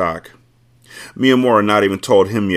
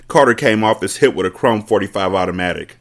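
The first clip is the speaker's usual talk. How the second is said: usual speaking voice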